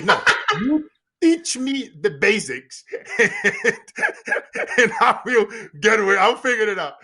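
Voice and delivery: retold plea, loud and broken by laughter; laughing insistence. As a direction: insist over your own laughs.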